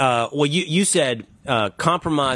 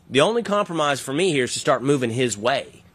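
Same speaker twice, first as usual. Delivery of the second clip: ordinary talk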